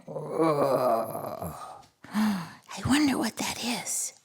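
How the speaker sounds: scared little whisper; soft scared whisper. Acted. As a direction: hush it like something is listening. Hushed wonder at the sound.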